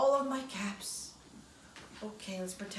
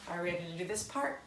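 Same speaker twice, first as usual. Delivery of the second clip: ordinary delivery, nothing marked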